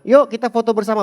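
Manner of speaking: loud cheerful call to gather; bright loud announcement. Retold or imitated. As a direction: call everyone in for a photo. Pastor calling for group photo.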